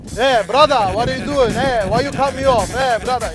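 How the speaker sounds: mock road-rage Balkan brother; a hint of a Balkan guy shouting at a driver. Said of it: "a guy like me might come outside of the car and be like".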